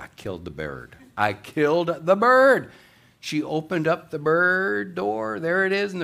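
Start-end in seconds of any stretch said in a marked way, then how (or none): 0.0-1.0 low, soft mock dismay; hushed realisation. Woman facing a silent freezer, hushed acted dismay. 1.2-2.7 loud, high, drawn-out acted wail; mock horror. Woman thinking her parrot is dead, loud mock horror.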